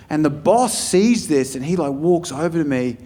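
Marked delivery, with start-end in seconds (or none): none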